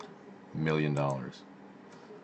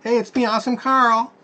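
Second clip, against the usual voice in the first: very high put-on cartoon voice, louder; Awesome Carl voice; 5 dB louder than usual. Host greets viewers as the silly Awesome Carl character. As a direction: squeak it out like a goofy puppet.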